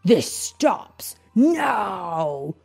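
very drawn-out heroic command, acted; furious cry. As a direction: stretch each word with stage fury. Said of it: "cried the Echidna with fury".

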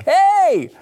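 loud, high game show host greeting; hammed-up host's drawn-out high shout; Caesar Flickerman. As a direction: burst out with a showy host's hello. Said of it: "game show host that was like".

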